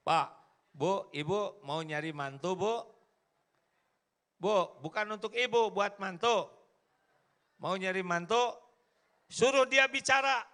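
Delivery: high, loud preacher addressing mothers; lively preaching. Real, not acted. Preacher teasing mothers seeking in-laws, loud and high. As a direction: call out to the mothers in the crowd.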